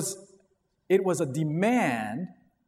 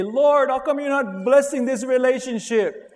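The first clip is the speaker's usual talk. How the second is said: higher, louder, put-on whiny voice; whiny complaint; 7 semitones higher, 5 dB louder than usual. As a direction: complain to the Lord like a sulking child. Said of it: "you say".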